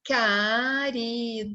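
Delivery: CARIDADE drawn out very slowly, ca-ri-da-de; split into syllables. Shown as a demonstration. Teacher showing a long word to children, syllable by syllable. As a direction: stretch each of the four syllables of caridade. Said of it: "Eu tenho quatro sílabas".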